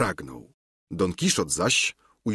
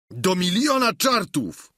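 high, exasperated exclamation; an exasperated cry, pitched well up; Sancho's outcry; 10 semitones higher, 3 dB louder than usual. A narrator's reading. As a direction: burst out in high-pitched indignation. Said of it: "zawołał Sancho".

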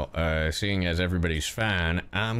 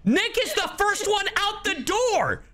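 excited call-out. Real, not acted. Announcing teammate bolts out.

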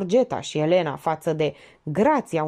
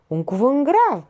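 slow, raised, louder offence; puffed-up indignation; 7 semitones higher, 5 dB louder than usual. A narrator's reading. Notary answering a charge of cheap insinuations, puffed-up indignation. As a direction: swell up pompously at the accusation. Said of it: "Moraru se umflă".

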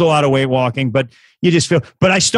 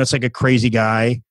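usual speaking voice

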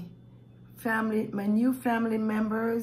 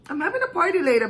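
fast, higher, louder burst; excited; 8 semitones higher, 7 dB louder, much faster than usual. Real, not acted. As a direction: blurt it out with glee. Excited aside about party.

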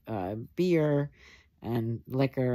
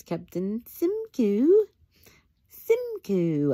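mocking name-calling; 9 semitones higher, 5 dB louder, slower than usual. Real, not acted. Mocking the disliked villain.

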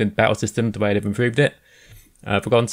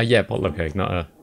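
ordinary talk, nothing marked

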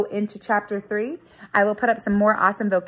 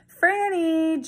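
high calling shout for a sister; 9 semitones higher than usual. Acted. John calling his sister across the prairie, high shout. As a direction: call her name out across the field. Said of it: "John shouted".

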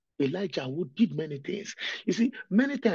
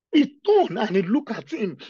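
louder, higher, through tears; crying; 6 semitones higher, 9 dB louder than usual. Real, not acted. Preacher weeping telling how the Lord looked at Peter. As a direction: cry it out as the words break. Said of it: "The reason why I'm crying".

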